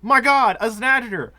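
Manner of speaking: loud, high shaken cry; shaken shout. Real, not acted. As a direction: still reeling from the fright. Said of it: "let me catch my breath".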